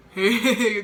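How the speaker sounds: loud, startled hey; startled exclamation. Retold or imitated. Recounting the attendant's order to pray, startled. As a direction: blurt out a taken-aback hey.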